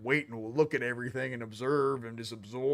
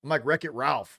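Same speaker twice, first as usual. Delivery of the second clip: usual speaking voice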